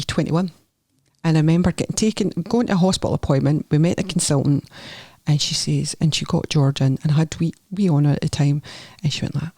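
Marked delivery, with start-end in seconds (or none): none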